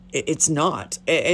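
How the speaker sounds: ordinary talk, nothing marked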